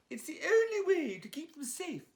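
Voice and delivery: grand posh English resolve, like an actress. Acted. Honey announces the plan to Leon in a high, loud posh English voice. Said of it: "said Honey".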